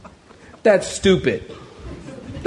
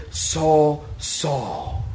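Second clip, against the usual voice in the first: hushed, drawn-out call from heaven; a solemn divine call; 3 semitones lower, 6 dB quieter than usual. Acted. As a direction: let the second name sink softly. Voice from heaven calls Saul.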